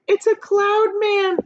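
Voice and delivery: high, slow, fearful cry; frightened cry. A narrator's reading. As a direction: shriek it out in fright. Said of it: "Miss Spider cried".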